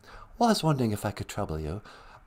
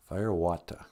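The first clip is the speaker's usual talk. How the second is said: put-on mild English accent; 6 semitones lower, 3 dB quieter than usual. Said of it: "I like how you say that".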